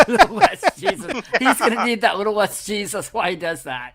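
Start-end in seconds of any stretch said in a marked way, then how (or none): 0.1-1.1 laughing. Real, not acted. Repeating the joke, laughing.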